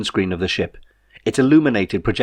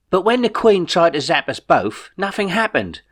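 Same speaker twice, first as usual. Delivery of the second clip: higher, bemused everyman voice; Jeff the puzzled friend; 10 semitones higher than usual. A narrator's reading. Jeff asks Ray how the remote works, higher and bemused. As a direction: puzzle it out aloud, a bit lost.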